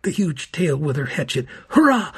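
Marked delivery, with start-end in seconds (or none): 1.7-2.1 high, loud exultant cheer; triumphant cheer. A narrator's reading. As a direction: burst out in victory. Narrator exults at the strike.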